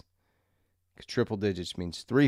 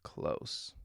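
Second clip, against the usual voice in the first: whisper; 10 dB quieter than usual. Real, not acted. Whispered echo of own punchline.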